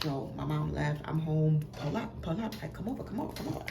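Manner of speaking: put-on hushed teen plotting while mom is out; hushed urgency. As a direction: sneak the boy over quietly. Sneaky text to boy.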